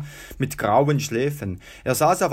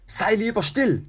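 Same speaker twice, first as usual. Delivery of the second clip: higher-pitched urgent hushing; hissed warning; 8 semitones higher than usual. A narrator's reading. Tertius silencing his reckless brother, hissed warning. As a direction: hush him sharply, pitch raised. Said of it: "zischte Tertius".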